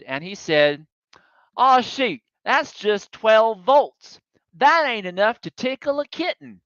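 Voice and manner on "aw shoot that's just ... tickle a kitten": put-on high, emphatic hillbilly mechanic in a Southern accent. Driver quoting hillbilly mechanic on the harmless spark, imitated Southern accent. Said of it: "in case my Southern accent was terrible".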